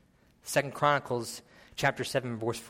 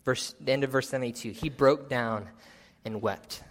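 usual speaking voice

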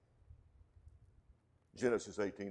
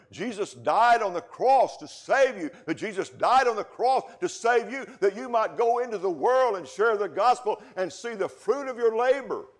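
loud, slowing preacher's cry; rising, ringing sermon delivery; preaching at full force; 12 semitones higher, 9 dB louder than usual. Acted. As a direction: drive it home to the congregation. Preaching climax on the cross.

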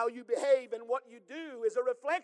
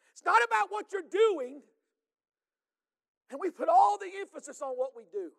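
rising, louder preaching voice that builds to a shout; full-force preaching; 6 semitones higher, 5 dB louder than usual. Acted. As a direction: preach it out to the congregation.

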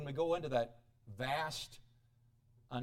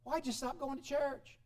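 a softer, higher concerned question; pastor checking on a member; 6 semitones higher, 5 dB quieter than usual. Retold or imitated. Pastor asks why someone quit church, concerned. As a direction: ask gently, caring but probing.

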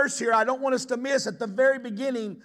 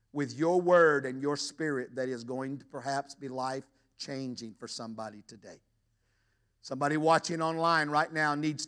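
prayer; 10 semitones lower than usual. Acted. Pastor opens with prayer.

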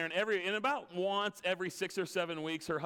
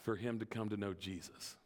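put-on an old woman admitting her doubt; understated sorrow of Patty; quiet confession; 4 semitones lower, 4 dB quieter than usual. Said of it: "She said".

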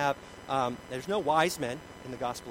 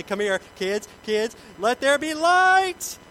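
much higher, louder and slow, like a booming pronouncement; grand proclamation; 8 semitones higher, 10 dB louder, slower than usual. Retold or imitated. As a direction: call the kids over, then boom out the command. Dad calling kids to see lights.

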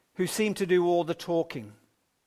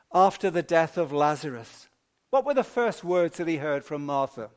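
normal talking voice

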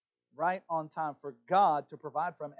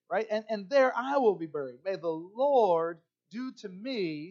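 impassioned scripture reading; 5 semitones higher, 7 dB louder than usual. Acted. Reads Ruth's vow aloud.

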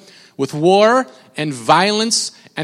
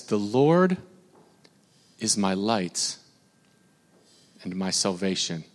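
low, soft, slow scripture reading; a quiet, reverent reading of a psalm verse; 9 semitones lower, 10 dB quieter, slower than usual. Acted. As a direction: read the verse hushed and reverent. Reads the psalm verse aloud.

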